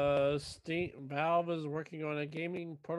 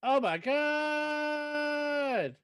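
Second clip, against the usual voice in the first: drawn-out exclamation; 11 semitones higher, 5 dB louder, much slower than usual. Acted. Mock exasperation.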